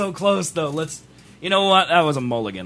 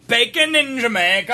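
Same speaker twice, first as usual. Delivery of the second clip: put-on Jamaican accent; 3 semitones higher than usual. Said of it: "you could say bacon in Jamaican".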